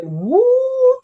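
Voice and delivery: sudden high thrilled whoop; excited woo. Real, not acted. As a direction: squeal with delight at the drawing. Admiring the dragon drawing.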